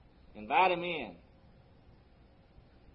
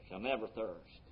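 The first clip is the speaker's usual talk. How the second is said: ordinary delivery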